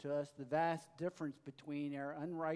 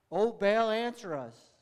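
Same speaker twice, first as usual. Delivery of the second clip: calling on a god; 6 semitones higher, 12 dB louder than usual. A narrator's reading. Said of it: "called upon the name of Baal".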